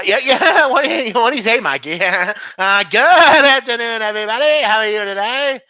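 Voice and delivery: high, loud, cackling radio host; manic, high-pitched sports radio impression; Mad Dog Russo. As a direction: yell the greeting with stretched vowels. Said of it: "pretty good with Mad Dog".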